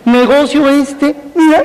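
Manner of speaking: mock-excited sales pitch, pitched well up. Mocking the network marketing pitch, high mock salesman voice.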